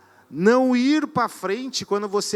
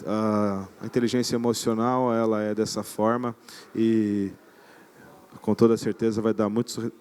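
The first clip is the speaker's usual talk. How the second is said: low, dull lecturer voice with no melody; boring monotone; 8 semitones lower than usual. Shown as a demonstration. Palestrante mostra à plateia como seria falar sem entonação, grave e monótono. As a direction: put the audience to sleep on purpose, lower and droning. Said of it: "falando desse jeito assim com vocês".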